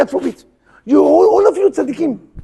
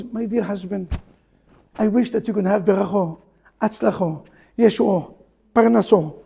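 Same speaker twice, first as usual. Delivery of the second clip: softer, lower tender blessing; sweet wife; 5 semitones lower, 3 dB quieter than usual. Retold or imitated. Rabbi voices a loving wife blessing her husband, soft and low. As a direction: bless him gently and lovingly.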